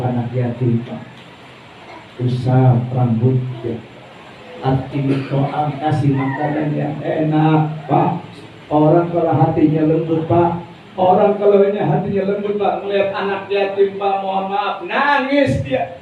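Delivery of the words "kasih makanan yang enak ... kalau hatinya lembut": ordinary talk, nothing marked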